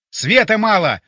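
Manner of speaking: loud, high urgent yell. A narrator's reading. Narrator to comrades as the fire gives too little light, yelling. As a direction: yell it across the room. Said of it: "заорал я".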